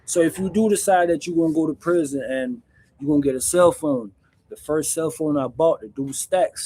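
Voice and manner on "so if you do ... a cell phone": ordinary delivery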